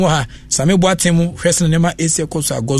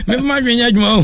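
higher-pitched, laughing delivery; amused; 7 semitones higher, faster than usual. Real, not acted. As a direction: say it through real laughter. Joking and laughing with co-host.

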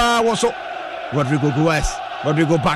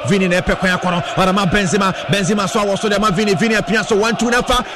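play-by-play commentary; 3 semitones lower than usual. Acted. Live play-by-play.

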